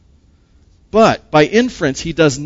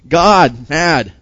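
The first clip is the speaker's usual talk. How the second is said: two words, each hit alone; God... mad, spaced out; punchy statement; 5 dB louder than usual. Acted. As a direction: say it like a verdict. Blunt emphatic statement.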